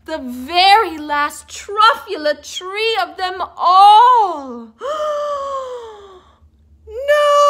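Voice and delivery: crying out, rising to a loud high scream of No; anguished cry. A narrator's reading. Onceler crying out as the last truffula tree falls.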